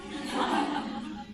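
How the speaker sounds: a quiet laugh at her own boldness; soft breathy laugh. Real, not acted. Speaker laughing at her own confident reply to the boss. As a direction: let out a little amused laugh under the breath.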